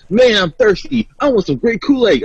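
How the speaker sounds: high-pitched, eager self-quote; eager retold craving. Retelling a fridge raid at the parents' house, high-pitched and eager. Acts out herself craving a drink.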